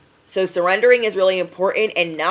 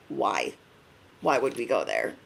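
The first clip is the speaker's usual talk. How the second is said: lower, softer, doubtful bestie; reluctant bestie; 9 semitones lower, 9 dB quieter than usual. Pretend. Bestie balking at going to the gym, low and doubtful. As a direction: balk at the idea, low and soft. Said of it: "Your bestie's gonna be like".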